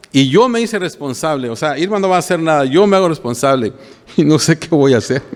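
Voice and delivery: normal talking voice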